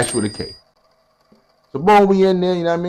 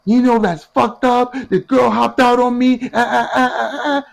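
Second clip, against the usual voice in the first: much higher, slow, whiny aggrieved woman; Peach complaining; 12 semitones higher than usual. Retold or imitated. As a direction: whine about being wronged, then stammer.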